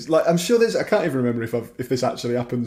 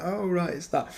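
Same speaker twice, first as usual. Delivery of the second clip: quieter recognition; 5 semitones higher, 6 dB quieter than usual. Retold or imitated. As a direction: imitate a fan noting it again, softly. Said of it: "you'll be like".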